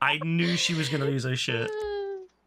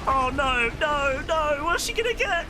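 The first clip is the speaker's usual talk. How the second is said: rising higher and higher in alarm; tense, climbing pitch as it unfolds; excited panic; 9 semitones higher than usual. Real, not acted. Watching friend about to crash.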